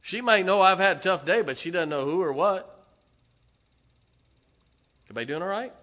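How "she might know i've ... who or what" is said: louder, higher pulpit push; preaching. Acted. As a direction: press the point home to the congregation. Preaching rises again.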